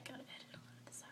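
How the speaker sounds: soft fast whisper to herself; whispered aside. Real, not acted. Vlogger muttering to herself about editing, whispered. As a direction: whisper it quickly, just for you. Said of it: "aside to herself".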